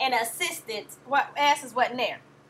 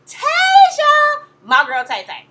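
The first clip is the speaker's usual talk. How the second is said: high, loud gushing shout of her name; excited shout-out; 13 semitones higher, 12 dB louder, much slower than usual. Real, not acted. Fan calling out her favorite bridesmaid, excited.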